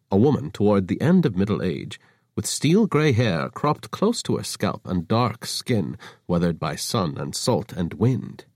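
ordinary delivery

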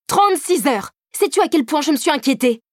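high, angry tirade; furious scolding; furious outburst. A narrator's reading. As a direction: let the worry burst out as anger. Angry man confronts lying lover.

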